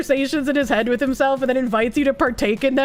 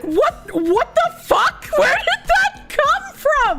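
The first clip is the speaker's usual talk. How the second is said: very high, drawn-out incredulous squeal breaking into laughter; baffled outburst; 12 semitones higher, slower than usual. Retold or imitated. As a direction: retell utter bafflement, hammed-up and squeaky. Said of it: "I was so confused".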